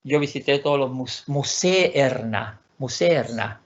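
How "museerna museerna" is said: museerna slowly, two e's sounded; Swedish word. Shown as a demonstration. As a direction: draw out museerna with both vowels. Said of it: "No es musé".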